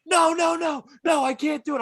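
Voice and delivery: high, loud mock panic; panicked refusal. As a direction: refuse frantically like a scared kid brother. Acting brother refusing in the car.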